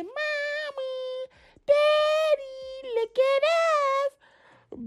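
put-on high childlike sing-song call; little girl voice. Retelling the girl calling to her parents, high little-girl voice. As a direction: imitate a small girl calling out sweetly. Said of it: "That girl said".

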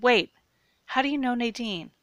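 loud, high startled demand; alarmed questioning. A narrator's reading. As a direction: break off and demand an answer in alarm. Alarmed captive questions his captor.

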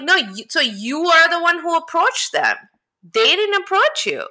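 high-pitched, insistent correction; mock indignant retort. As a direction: argue back, pitch climbing to the end. Retorting to absent cast member.

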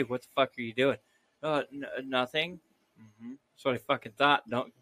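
usual speaking voice

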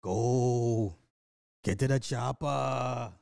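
slow, drawn-out action-hero line; slow mock action-movie accent; Schwarzenegger-style quote. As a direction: bark it like a movie commando. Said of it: "repeated movie line".

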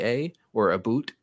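stock Canadian "a or a boot"; mock Canadian, "boot" with a long oo; Canadian accent. Shown as a demonstration. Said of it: "I don't say a boot".

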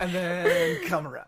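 slow, high, drawn-out THEN before "come around"; mock instruction. Mimicking TikTok directions for friends, slow and drawn-out. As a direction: stretch "then" way out in a playful high voice.

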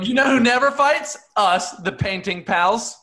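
high, loud chirpy boast; smug neighbour. Acted. Neighbour bragging to fighting painters, high loud boast. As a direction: crow it across the street.

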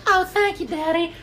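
high falsetto Texan daughter; squeaky put-on Southern girl voice; Ruth. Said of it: "she talks like that clearly".

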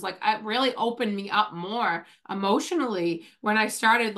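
usual speaking voice

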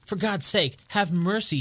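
high, pleading shriek for mercy; a terrified thief shrieking to Holmes for mercy. A narrator's reading. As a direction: beg in a high panicked cry. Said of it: "he shrieked".

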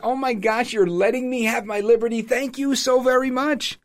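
high, gushing fake gratitude; mock excitement. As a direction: gush sarcastically, stretching out the thanks. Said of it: "like, getting excited".

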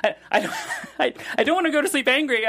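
high, stammering, laughing worry; half-laughing panic over going to bed angry; anxious pleading. Retold or imitated. Boyfriend fretting to his calm girlfriend, high, stammering and laughing. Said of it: "I'm like".

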